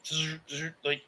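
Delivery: ordinary talk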